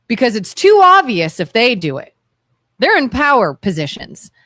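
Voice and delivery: normal talking voice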